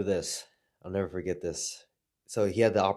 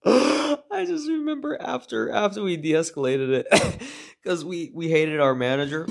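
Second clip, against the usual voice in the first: high-pitched words broken up by laughter; cracking up while telling it; laughing; 9 semitones higher, 6 dB louder than usual. Real, not acted. Recalling the manager story, laughing hard.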